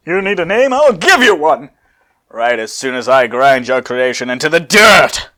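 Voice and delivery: loud mocking threat; loud taunting villain; Cell shouting. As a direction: shout the threat loudly at the rival. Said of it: "Cell shouts".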